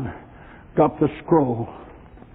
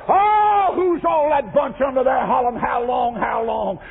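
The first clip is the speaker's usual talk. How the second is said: amazed cry; 6 semitones higher than usual. Acted. John amazed at crowd in glory.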